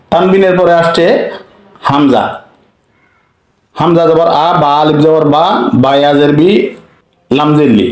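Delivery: ordinary talk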